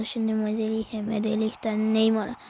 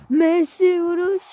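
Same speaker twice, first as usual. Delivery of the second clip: excited commentator; 6 semitones higher, 5 dB louder than usual. Acted. Shot in live commentary.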